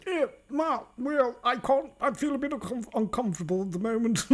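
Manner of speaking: high, put-on comic voice; put-on character voice. Acted silly character, high voice.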